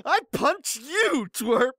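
slow, high-pitched petulant threat; mock angry threat. Poster threatening his online enemy, mock angry. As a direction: land each word like a punch. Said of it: "mutter your U R L with every strong punch".

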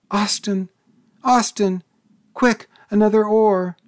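high, loud frantic shriek. A narrator's reading. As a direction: scream for help in panic. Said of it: "she shrieked".

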